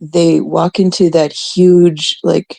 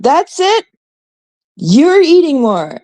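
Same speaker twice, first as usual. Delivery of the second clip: much higher scolding grandma, slowing on the second line; grandmother's voice; 11 semitones higher, slower than usual. Retold or imitated. Grandmother scolds granddaughter for gaining weight, high nagging voice. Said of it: "and my grandmother said".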